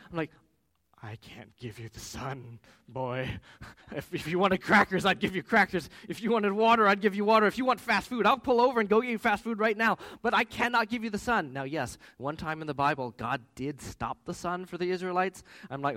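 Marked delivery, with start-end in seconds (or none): 1.0-3.4 slow, lower-key acted fatherly refusal; retold measured refusal. Father answers upset son, slow mock-patient refusal. 3.9-11.5 loud, high, acted exasperation; exasperated father rant. Father pleads with tantruming son, loud mock exasperation.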